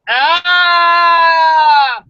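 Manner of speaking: loud high scream to cut off the swear; mock scream. Host screaming instead of reading the swear, loud high scream. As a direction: scream over the punchline.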